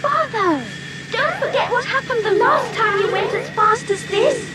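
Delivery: put-on very high-pitched anxious child; anxious exclamation. A narrator's reading. Kirill warns Father about going too fast, anxiously. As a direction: warn urgently in a high, worried voice. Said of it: "exclaimed anxiously".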